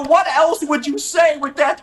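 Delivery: high, loud exasperated voice; hammed-up knowing retort; mock exasperation. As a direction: act it out like it's obvious. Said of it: "I go".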